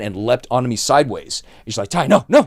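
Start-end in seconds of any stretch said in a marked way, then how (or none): 1.9-2.4 raised, loud voice of alarm; mock frantic plea. Manager leaping on the agent to stop him, urgent cry. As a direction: imitate someone urgently holding a friend back.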